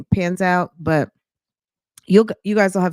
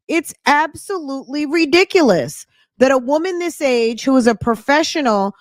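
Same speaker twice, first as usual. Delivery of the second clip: ordinary talk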